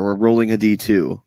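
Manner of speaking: usual speaking voice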